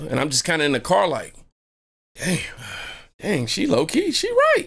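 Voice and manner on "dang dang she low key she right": high squeak of reluctant realization; grudging surprise. Retold or imitated. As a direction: admit it like it hurts.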